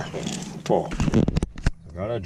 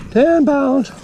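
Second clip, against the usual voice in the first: much higher, louder ten pounds; thrilled announcement; 4 dB louder than usual. Real, not acted. Telling viewers the catfish weighs ten pounds, thrilled and high.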